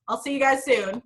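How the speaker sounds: ordinary talk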